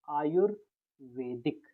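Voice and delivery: Ayurvedic stretched out very slowly, each syllable clear; teacher dictating Ayurvedic slowly; slow spelling demo. Shown as a demonstration. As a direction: sound out Ayurvedic slowly so students can write it. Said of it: "हमको बताना है आयुर्वेदिक".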